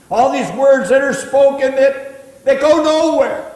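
loud, high preaching over the crowd; full-force preaching. Acted. Preacher driving the point home to the church, loud and high. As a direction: lift it up and preach it out.

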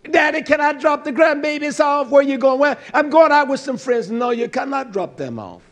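preacher voicing daughter and father; acted family exchange. Daughter asks daddy to take the grandbabies, acted exchange. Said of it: "That's how you say that".

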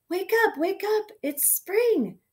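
high, loud, excited cheer; storybook dog's excited wake-up call. A narrator's reading. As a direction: rouse them with a big cheer. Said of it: "cheered the dog".

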